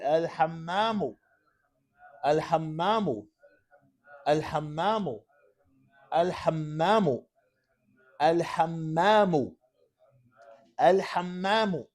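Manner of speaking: drawn-out, evenly repeated model word; slow dictation. Shown as a demonstration. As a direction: dictate it slowly so they can write. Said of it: "Write it while you say it".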